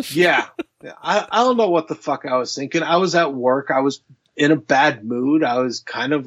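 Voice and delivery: ordinary delivery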